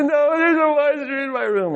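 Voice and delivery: high mock wail of a toddler; crying little boy. Son won't be reassured by dad, mock crying. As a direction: imitate a small child's tearful, high-pitched insistence. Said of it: "he said".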